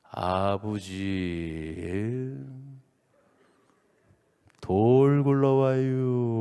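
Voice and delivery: very slow, stretched-out vowels; comically unhurried Chungcheong warning; slow Chungcheong drawl. Retold or imitated. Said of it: "얼마나 느려". (느릿느릿한 충청도 말투로)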